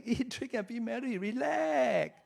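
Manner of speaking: high, airy lazy-retiree voice, sighing out relax; carefree mock voice. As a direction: sell the easy life like a tempting daydream.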